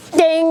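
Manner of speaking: high loud bell ding. Retold or imitated. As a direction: ring it out like a bell. Click that big bell.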